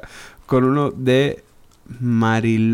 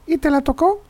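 put-on high kid's question; child's voice. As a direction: ask like a curious child. Child asking father.

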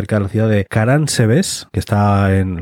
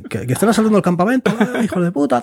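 much higher, fast panicked shouting; frantic alarm; 11 semitones higher than usual. Retold or imitated. Soldiers think the Turks attack the camp, high frantic shouts. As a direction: shout the alarm frantically, stumbling over words.

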